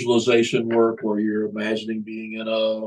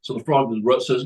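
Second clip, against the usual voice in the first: ordinary delivery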